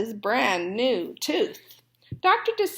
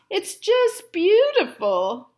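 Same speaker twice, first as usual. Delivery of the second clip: higher, slow and far-off admiring voice; 8 semitones higher, 4 dB louder, slower than usual. Acted. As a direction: drink in the sight of the gold. Fox admiring gold tooth.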